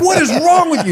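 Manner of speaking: high-pitched incredulous mock outburst; outraged. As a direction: act out yelling in disbelief. Retold outburst at reckless driver.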